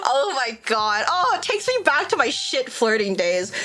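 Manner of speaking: normal talking voice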